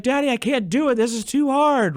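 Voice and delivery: put-on high, whiny child voice; whiny little daughter. Dad voicing his little daughter giving up, high and whiny. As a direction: play a small girl giving up. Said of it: "So she's like".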